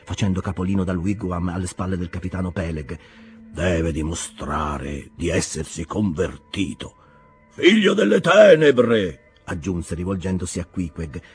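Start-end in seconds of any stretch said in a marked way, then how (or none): 3.5-7.0 slow, low, grave preacher voice; sepulchral voice. Acted. Captain Bildad insisting on conversion, sepulchral. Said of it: "con la sua voce sepolcrale". 7.5-9.3 very slow, much louder, higher dramatic call; booming sepulchral address. Acted. Bildad addressing Queequeg, booming and solemn. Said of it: "con la sua voce sepolcrale".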